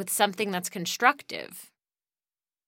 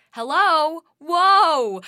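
loud, high exclamation; high, loud surprised whoa; startled hello; 9 semitones higher, 13 dB louder, much slower than usual. Real, not acted. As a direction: shout out the realization. A startling realization.

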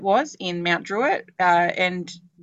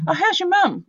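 much higher, bright casual asker; chirpy small-talk question; 11 semitones higher, 4 dB louder than usual. Pretend. A neighbour asking after mum, breezy and much higher. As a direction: toss it off like a cheery acquaintance.

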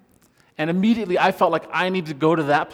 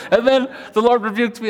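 laughing; 7 semitones higher, 7 dB louder than usual. Real, not acted. Laughing through the punchline.

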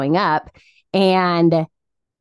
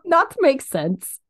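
slow, higher mock Russian accent; 7 semitones higher, slower than usual. Cohost joking about the odd sayings, slow Russian accent. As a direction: draw it out in a put-on Russian accent. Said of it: "that accent".

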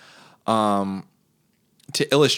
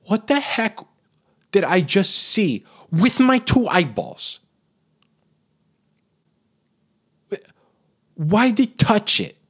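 shocked; 10 semitones higher, 4 dB louder than usual. Retold or imitated. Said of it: "I was like".

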